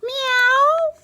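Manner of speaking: high, loud little kitten meow. Acted. Hidden kitten meows at Amelia, high loud mewing. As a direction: squeak it out like a tiny hidden kitten.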